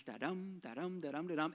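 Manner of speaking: da-DUM, stress on DUM every time; even, quick run of da-dums; regular iambic beat. Shown as a demonstration. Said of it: "very regular, eight syllables".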